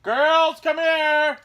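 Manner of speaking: high, loud yell; real shout across the house; calling out. Real, not acted. Calling the children into the room.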